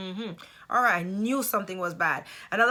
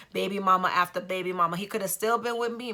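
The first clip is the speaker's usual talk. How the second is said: normal talking voice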